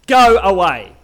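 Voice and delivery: loud, forceful order to leave; angry dismissal. Shown as a demonstration. Preacher acting out a rejection, angry dismissal.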